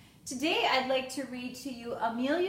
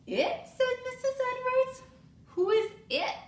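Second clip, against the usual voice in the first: much higher, bewildered questions; puzzled teacher; 13 semitones higher, 4 dB louder than usual. Acted. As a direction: sound confused and amused.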